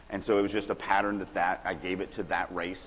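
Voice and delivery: usual speaking voice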